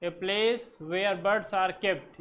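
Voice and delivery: slow, spaced-out definition; slow dictation. Shown as a demonstration. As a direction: read it slowly so they can write. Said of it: "teacher dictating definition slowly".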